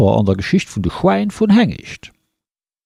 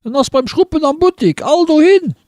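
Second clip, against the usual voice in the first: much higher, excited street calling; villagers calling out; 12 semitones higher than usual. Acted. Villagers shouting the news through the streets, high excited calls. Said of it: "hun se gerufen".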